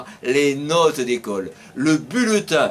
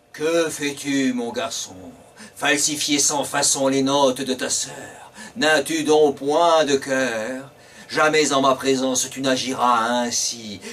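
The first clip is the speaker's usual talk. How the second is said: lower, solemn genie voice; 3 semitones lower than usual. Acted. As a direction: speak as a grave spirit, lower. Genie speaks to the boy.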